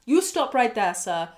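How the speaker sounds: sudden loud call to halt; hey, stop him right there, loud; loud interruption. Real, not acted. Host cuts in on OP, calling out loudly. Host stops OP mid story.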